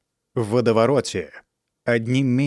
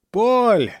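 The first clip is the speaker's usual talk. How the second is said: higher, louder desperate shout; 10 semitones higher, 5 dB louder than usual. A narrator's reading. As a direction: yell the name with all strength. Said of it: "Снова принялся кричать Жан".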